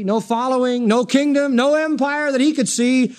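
usual speaking voice

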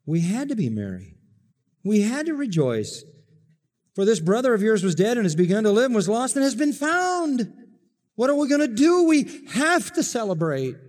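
high, rising joy; father urging the son to rejoice; joyful father. Acted. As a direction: burst out with celebration. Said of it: "verse thirty-two".

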